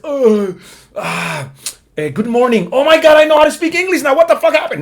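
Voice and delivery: "Oh my God" mucho más agudo y fuerte, burlón; asombro burlón. Pretend. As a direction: exagera el despertar milagroso. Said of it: "un día despiertes y digas". (alguien que amanece hablando inglés, asombro exagerado)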